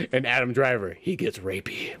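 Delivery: dropping to a near whisper; low aside, fading into whisper; hushed reveal. Acted. Hushed mock narration of plot turn.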